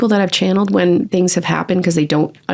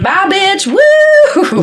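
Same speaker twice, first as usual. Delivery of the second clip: much higher, cheery mock farewell with a whoop; imitating her joyful mom; 14 semitones higher, 4 dB louder, much slower than usual. Daughter mimics her late mom waving a happy goodbye. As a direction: throw a high, gleeful goodbye and cheer. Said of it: "She's like".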